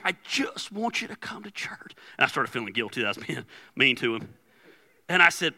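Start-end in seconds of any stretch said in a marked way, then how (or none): 0.0-1.9 hushed, whispery mock tearful plea; soft whispered begging, retold; imitated teary-eyed plea. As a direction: imitate a young man pleading through tears, barely voiced. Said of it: "he got teary-eyed".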